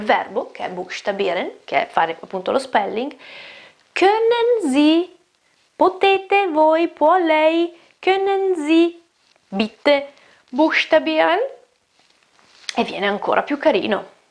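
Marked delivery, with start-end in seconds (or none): 3.9-11.4 high, slow, sweetly polite asking; polite sweet request. Shown as a demonstration. Teacher models a nicer polite way to ask for spelling. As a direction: ask charmingly with a lifted voice. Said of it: "È un pochino più carino".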